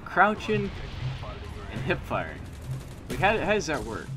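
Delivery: exasperated. Real, not acted. Frustrated disbelief.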